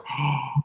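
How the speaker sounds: sharp excited gasp, high and hushed; wife hearing about the trip, a soft excited gasp; imitated excited gasp. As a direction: gasp like you just saw the prize. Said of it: "my wife is like".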